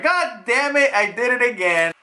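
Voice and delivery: high, comic exasperated curse; mock-frustrated outburst. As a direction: kick yourself for a silly blunder. Kizaru cursing his own blunder.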